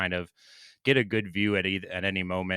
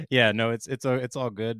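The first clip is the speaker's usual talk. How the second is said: ordinary delivery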